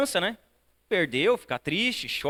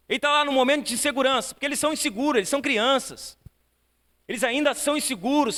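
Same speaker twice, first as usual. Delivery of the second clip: fervent preaching; 6 semitones higher than usual. Acted. A pastor urges parents to encourage their children, fervent preaching. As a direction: press the congregation with urgent questions.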